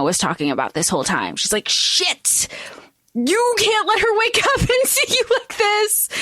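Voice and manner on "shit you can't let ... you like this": high, breathy, laughing panic; high whispered panic breaking into a laugh; panicked whisper. Retold or imitated. Character panicking, acted quote.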